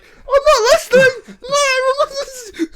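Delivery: anxious dog whimpering to avoid its owner's scolding. Retold or imitated. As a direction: play a terrified little dog covering its ears. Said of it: "the other little dog's like".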